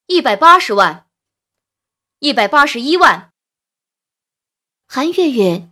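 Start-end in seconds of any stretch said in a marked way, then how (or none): 0.1-3.3 loud, punchy bidding war; calling bids. A narrator's reading. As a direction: shout out rival bids. Rival bidders calling out.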